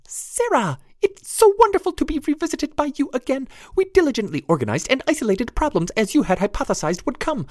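tiny high pixie voice; prim, squeaky little pixie. Acted. Narrator voices pixie Jenny.

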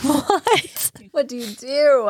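high, incredulous what-do-you-do; stunned disbelief. Retold or imitated. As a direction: squeak it out in laughing disbelief. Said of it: "I was like".

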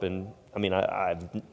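ordinary talk

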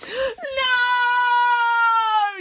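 long, very high scream; high-pitched wailing shriek. A narrator's reading. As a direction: scream it out in protest, drawn right out. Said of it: "she screamed".

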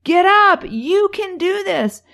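high, loud yelled encouragement; cheering dad. Retold or imitated. Dad in the crowd urging his son again, loud high cheering. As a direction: call out fiercely from the stands, urging him on. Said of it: "sees his dad again cheering him".

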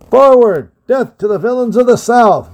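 high, loud charging yell; cried out. Acted. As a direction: urge them on into the attack. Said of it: "Rodney cried out".

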